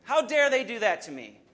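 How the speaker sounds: indignant cry, high and loud; acted anger. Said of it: "you get mad, and you're like".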